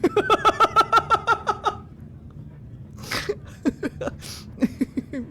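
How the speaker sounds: breathless high laughter; long silent laugh. Real, not acted.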